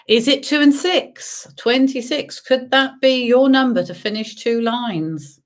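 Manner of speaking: sing-song number calling; bingo caller. Acted. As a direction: call each number out, drawn out. Calls bingo numbers to players.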